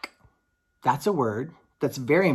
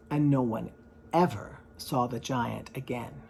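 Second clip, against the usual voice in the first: plain reading voice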